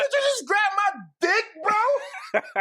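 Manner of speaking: high, loud mock outrage; hammed-up shouting at a groper. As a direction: yell it across the whole party. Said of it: "Real loud, real loud".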